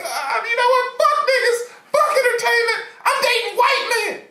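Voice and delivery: put-on very high, loud, sing-song exasperated girlfriend; fed-up shout. Fed-up Kamala shouts that she's done with entertainers. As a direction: throw your hands up and yell it.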